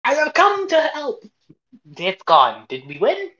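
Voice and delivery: high, loud heroic announcement; heroic arrival voice. Acted. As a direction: proclaim it like a rescuer. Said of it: "It's like".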